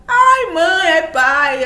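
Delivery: high, loud pleading whine; whiny child. Retold or imitated. Child making excuses to mom and dad, loud high whine. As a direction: beg like a kid dodging chores.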